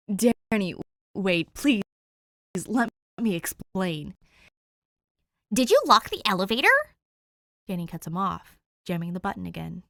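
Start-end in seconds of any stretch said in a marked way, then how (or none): 0.1-4.1 loud, urgent plea; urgent pleading. Acted. Dick, trying to stop Danny at the elevator, loud urgent plea. Dick begging her to stay. 5.5-6.7 higher, much louder, fast accusation; angry accusation. Acted. Danny, trapped in the locked elevator, angrily accusing Dick.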